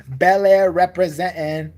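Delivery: slow, loud mock-rapper swagger; wannabe gangster rapper. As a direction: parody a rich kid acting street. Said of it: "gonna be a gangster rapper".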